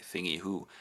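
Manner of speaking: plain reading voice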